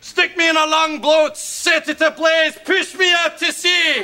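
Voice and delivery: hammed-up martyr's lament, rising in pitch; melodramatic. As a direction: declaim it like a wronged father. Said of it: "don't be so dramatic".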